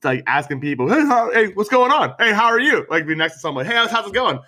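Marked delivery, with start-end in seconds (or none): 0.9-2.9 high, bright customer-service hello; chirpy server greeting. Retold or imitated. Imitates his hospitality-mode greeting. 3.7-4.4 high, bright customer-service hello; chirpy server greeting. Retold or imitated. Imitates his hospitality-mode greeting.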